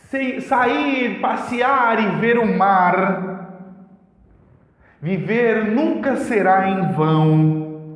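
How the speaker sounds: slow, measured reading of a little rhyming poem; poem recital. Shown as a demonstration. Teacher recites a simple poem to show alternating rhyme, slow and measured. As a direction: let each line ring out like verse. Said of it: "Vou fazer um exemplo bem básico".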